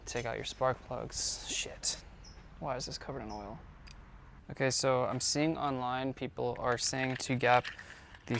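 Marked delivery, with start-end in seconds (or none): 0.1-3.6 hushed dismayed whisper. Real, not acted. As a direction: breathe the words out unvoiced. Dismay at oily plug.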